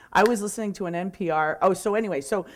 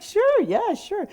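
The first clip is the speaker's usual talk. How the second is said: eager; 3 dB louder, slower than usual. Retold or imitated. Eagerly accepting the speaking offer.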